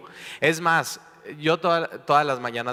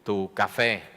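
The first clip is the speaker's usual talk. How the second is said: normal talking voice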